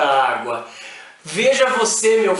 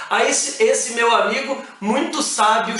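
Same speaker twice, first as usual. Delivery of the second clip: normal talking voice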